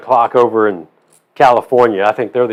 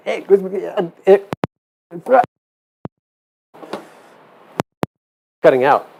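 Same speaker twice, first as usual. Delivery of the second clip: corrupted glitchy audio; 7 semitones higher than usual. Pretend. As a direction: fake cutting-out phone line. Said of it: "That's what I like to do whenever telemarketers call".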